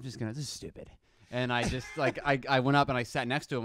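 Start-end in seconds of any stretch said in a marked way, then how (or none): 0.0-0.9 muttered aside. Retold or imitated. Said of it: "I was like".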